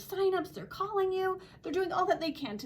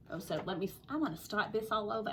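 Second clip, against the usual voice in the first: usual speaking voice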